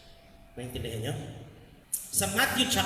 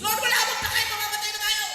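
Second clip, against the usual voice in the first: high, loud, desperate cry; panicked cry to Jesus; 13 semitones higher, 5 dB louder than usual. Acted. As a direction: yell to Lord as the boat sinks. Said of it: "Sasabihan mo si Jesus". (natatarantang sigaw kay Jesus)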